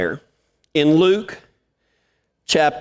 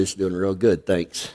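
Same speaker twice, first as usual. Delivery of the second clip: much lower, softer, understated; low soft it's doing real good; low modest reply; 11 semitones lower, 6 dB quieter than usual. Retold or imitated. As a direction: answer it quietly, no fuss. His low quiet reply.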